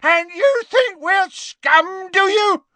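slow, very high, loud scream. Acted. Father rounding on the official, screaming. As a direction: scream it in outrage. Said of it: "screamed Gaunt".

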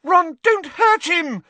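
very high, loud alarmed squeal; pleading high-pitched cry. A narrator's reading. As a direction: squeal it in panic, drawn out. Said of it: "squealed Hermione".

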